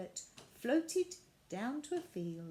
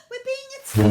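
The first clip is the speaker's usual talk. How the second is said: alarmed; 20 dB louder than usual. A narrator's reading. Violet fearing loud bangs.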